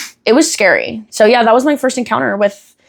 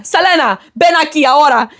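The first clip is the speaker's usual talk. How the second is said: put-on high-pitched scolding in a Spanish accent; Spanish mom; 7 semitones higher than usual. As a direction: call the child over as a cross Spanish mom. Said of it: "she's Spanish".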